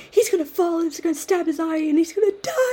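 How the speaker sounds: high, loud hammed-up alarm; mock panic. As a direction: act out panicking about Angel getting hurt. Panicked self quote.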